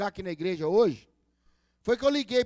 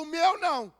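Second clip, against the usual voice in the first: a higher, louder mock protest; Peter protesting; 6 semitones higher, 4 dB louder than usual. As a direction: push back like a startled disciple. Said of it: "Aí Pedro falou". (Pedro protestando, mais agudo)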